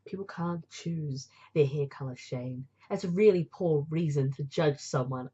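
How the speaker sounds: low character voice, reproachful; reproving young man. Acted. Friend telling Shane off over hair judgement, reproving. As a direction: drop the pitch and rebuke him.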